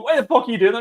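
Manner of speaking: high mock-indignant protest; imitated screaming. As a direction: mimic an outraged protester yelling at police. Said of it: "you're screaming at them going".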